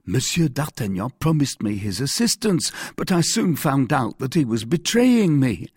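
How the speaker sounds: high, anxious confession; contrite Bonacieux. Acted. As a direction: admit it humbly to the authority. Bonacieux explains himself.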